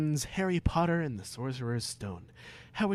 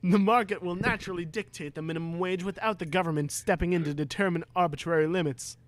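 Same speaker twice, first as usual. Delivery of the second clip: rapid high sermon; speechify fast and lofty; fast preaching Harry, higher; 5 semitones higher, faster than usual. Acted. Harry lecturing Voldemort, fast and high.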